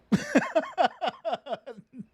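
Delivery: high burst of laughter; laughing. Real, not acted. Host laughing at his joke, a high burst of laughter. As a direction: crack up at your own joke.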